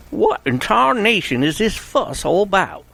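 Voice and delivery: high, slow hammed-up Southern drawl; a sheriff putting on a Southern accent for the room; fake Southern accent. Sheriff to the children, fake Southern accent, high and slow. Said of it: "in his best fake Southern accent".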